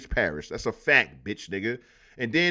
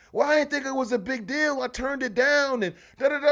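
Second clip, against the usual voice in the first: high, defensive wife voice; imitated wife; 11 semitones higher than usual. Wife downplays the lunch offer to husband, high and dismissive. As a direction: brush it off in a mimicked high voice. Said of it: "she in the bedroom like".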